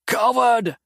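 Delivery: loud, high angry snarl; a demon bound in vines, snarling at his captor. A narrator's reading. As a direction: spit the insult at him. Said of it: "Mattis snarled".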